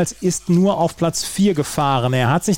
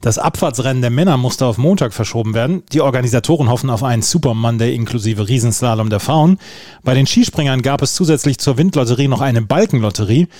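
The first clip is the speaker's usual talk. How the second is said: punchy, lower-pitched sports news teaser; headline read; 3 semitones lower, 4 dB louder than usual. Acted. Host teases the day's Olympic results to listeners, punchy headline read.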